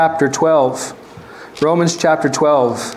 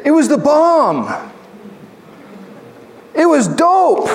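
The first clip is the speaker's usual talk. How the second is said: higher, slow, cool-dude praise; slangy churchgoer; 10 semitones higher, slower than usual. Retold or imitated. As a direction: play a hip young guy. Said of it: "some people say".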